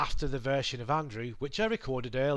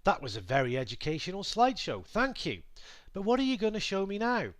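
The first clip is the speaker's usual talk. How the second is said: lightly voiced eager viewer, quick; teacher voicing his audience in a hint of a student's voice; imagined viewer; 6 semitones higher than usual. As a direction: play a keen student rattling off praise, rising at the end. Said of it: "I hear you say".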